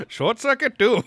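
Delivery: put-on Indian accent, rising high and loud on the title; mock Indian accent. Host repeats own line for laughs, Indian accent.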